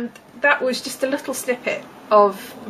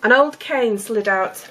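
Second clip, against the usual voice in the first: ordinary talk, nothing marked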